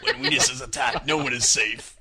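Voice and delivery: loud, ominous trailer narration; horror trailer voice. Acted. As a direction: announce doom like a horror trailer. Mock movie trailer line.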